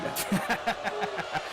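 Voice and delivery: smug heel laugh at the crowd; gloating evil laugh; villain's gloating laugh. Acted.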